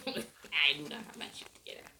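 whispered confession, barely voiced; whispering. Real, not acted. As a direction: whisper it like a secret aside. Confessing aside to herself.

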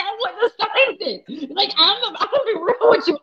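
high and laughing through it. Retold or imitated. Tells the friend she'd never sign, laughing. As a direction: chuckle it out, high. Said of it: "I was like".

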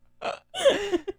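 loud, high burst of laughter. Real, not acted. Co-host cracking up at own Aquaman joke, loud high laugh.

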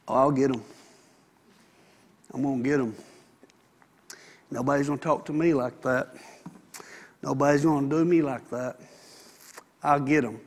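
low, quiet muttered grudge; a man brooding over payback, low and quiet; vengeful grudge. Retold or imitated. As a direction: grumble it like a man set on revenge. Voicing a vengeful man's thoughts.

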